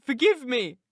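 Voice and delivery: high, loud, impassioned plea; wrought-up heroine begging forgiveness; anguished woman. Acted. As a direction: cry it out in anguish, pitched high. Dying Catherine in an emotional scene.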